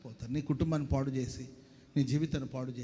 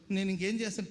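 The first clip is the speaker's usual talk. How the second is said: normal talking voice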